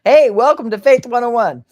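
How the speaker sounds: loud welcome. Real, not acted. Woman welcomes viewers to Faith one oh one, loudly. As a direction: call it out like a class opener.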